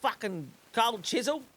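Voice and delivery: high, pleading request for a song; eager mock request. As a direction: ask the karaoke guy, hopeful and high. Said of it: "and I'm like".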